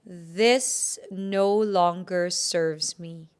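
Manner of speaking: slow, firm, measured affirmation; slow declaration. Shown as a demonstration. Healer leading listeners to declare to the bubble, slow declaration.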